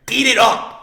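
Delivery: loud, high burst of relish; hype shout. Real, not acted. Fan relishing the rivalry scene, hype shout.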